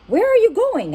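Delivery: high, worried question; anxious. Acted. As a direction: ask in sudden alarm. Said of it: "asked Frog anxiously".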